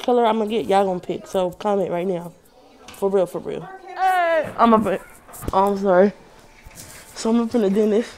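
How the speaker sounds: muffled behind surgical mask; speaking through face mask. Real, not acted. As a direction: mouth covered by mask. Seen 1: Mask covers mouth and nose throughout; references show bare face.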